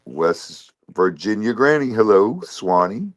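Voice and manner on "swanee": usual speaking voice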